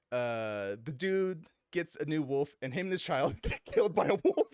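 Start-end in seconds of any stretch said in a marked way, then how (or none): none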